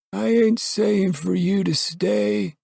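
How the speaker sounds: put-on high old man's voice, stubborn; stubborn Grandpa. Grandpa insists to Al and Pa he's fine, high and stubborn. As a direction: crank it up high like a cranky grandpa.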